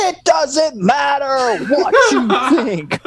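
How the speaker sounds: high, slow laughing shout; mock The Rock catchphrase. Friend imitates The Rock dismissing Moana, laughing shouted catchphrase. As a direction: bark it out like the wrestler, laughing. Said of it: "the big guy turns to her".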